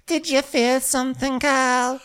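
very high put-on voice; exaggerated falsetto fussing; doting crewmate. Crewmate questioning injured Kyle, high mock coddling. Continues the high Natasha impression.

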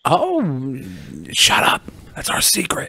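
whispered secret. Acted. Hushing a joke.

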